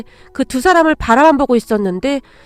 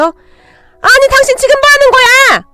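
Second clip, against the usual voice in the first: very high, loud, drawn-out yell; slow shrieking protest, acted; shocked shriek; 12 semitones higher, 6 dB louder, slower than usual. A narrator's reading. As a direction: cry out in outraged disbelief. Mother shocked at being shoved.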